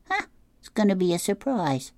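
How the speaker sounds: put-on high kid voice, breezy; a pup keeping a secret from dad; little boy shark. Herman answers his dad.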